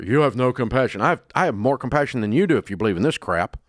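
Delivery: normal talking voice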